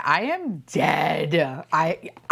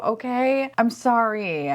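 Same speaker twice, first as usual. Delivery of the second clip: ordinary talk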